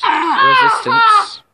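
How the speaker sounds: mock wail. Mock anguish during commentary.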